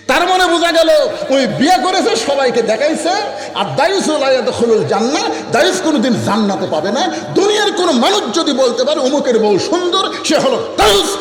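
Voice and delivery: high, forceful sermon warning; full-force preaching. Real, not acted. Preacher warning the congregation, at full force. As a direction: pitch it high and hammer it out.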